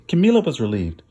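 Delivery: plain reading voice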